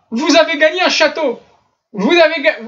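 high-pitched prize announcement; hammed-up scam pitch; mock spam announcer. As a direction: proclaim a fake jackpot, pitched high. Reads out an imagined spam email.

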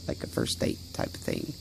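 secretive hushed aside; whispered aside. Real, not acted. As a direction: confide it in a whisper. Drops to whisper.

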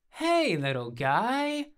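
high, drawn-out doting greeting; cooing to a puppy. A narrator's reading. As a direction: coo slowly at a little dog.

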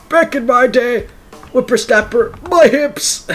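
put-on high creaky old geezer; old man voice. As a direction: grumble like a cranky old man. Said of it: "Cuttlefish impression".

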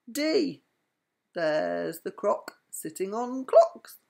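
lively read-aloud with voiced animals; bedtime story reading. A narrator's reading.

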